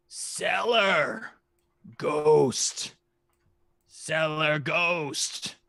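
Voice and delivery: high, drawn-out dragon hint; a dragon dropping a slow cryptic clue. Acted. Dragon hinting at the cellar for gold, high slow voice.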